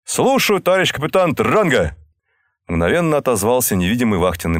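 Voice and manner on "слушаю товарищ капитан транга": high, eager sailor reply; young sailor voice. A narrator's reading. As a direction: snap back a ready answer. Sailor answers from the dark.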